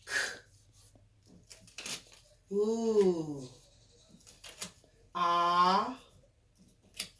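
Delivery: clock split into single sounds K, ooh, ah, K; sounding out phonemes. Shown as a demonstration. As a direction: say each sound of clock on its own, with pauses. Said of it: "this is what I'm gonna get".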